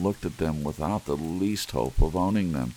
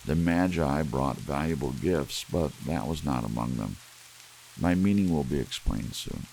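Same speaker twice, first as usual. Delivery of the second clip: ordinary delivery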